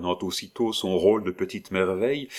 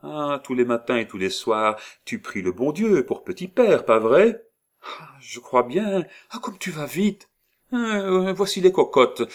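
put-on higher, louder doting old woman; 8 semitones higher, 6 dB louder than usual. Disguised old woman chatters to the child, higher and louder. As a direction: chatter on like a fond granny.

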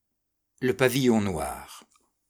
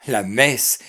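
ordinary delivery, nothing marked

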